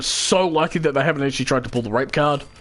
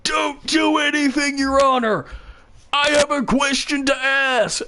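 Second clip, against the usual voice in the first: dramatic courtroom shout; 14 semitones higher, slower than usual. Acted. Friend bursting into court to stop the verdict, shouting high. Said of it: "Blaze will burst in the doors".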